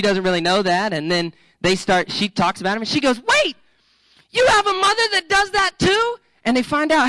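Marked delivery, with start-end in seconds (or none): 3.2-6.2 much higher, amazed realization; light up as if you found a kindred spirit; surprised delight. Retold or imitated. Girl on blind date discovering his mom is like hers, amazed.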